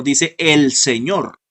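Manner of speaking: "dice el Señor" slowed down, EL stressed. Shown as a demonstration. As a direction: draw out "el Señor", pressing on EL. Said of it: "no dice los señores".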